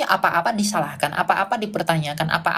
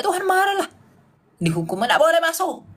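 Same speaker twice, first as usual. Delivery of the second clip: mock scolding voice; 10 semitones higher than usual. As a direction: ham up a cross God scolding grumblers. Mocking grumbling Israelites' God. (suara pura-pura marah, tinggi dan keras)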